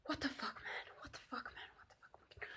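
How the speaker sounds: unvoiced, fading whisper of disbelief; hushed, freaked-out whisper; shocked whisper. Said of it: "I got so fucking freaked".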